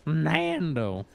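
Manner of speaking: "Nando" with a stretched American a; mock "Nando" as a drawn-out "NAAAN-doh"; exaggerated American pronunciation. Caster teasing co-caster's version, "Nando" with long American a. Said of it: "It sounds very American".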